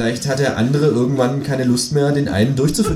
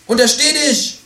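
very high creature voice, exclaiming; Känguru, calling out; 4 dB louder than usual. A narrator's reading. Kangaroo bursts back in to stop writer, high outcry. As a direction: cry a warning, pitched right up. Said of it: "rief das Känguru".

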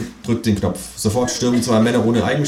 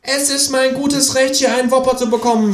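outraged high-pitched kangaroo protest; snorting indignation. A narrator's reading. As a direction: insist on your rights in a high voice. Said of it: "schnaubt das Känguru".

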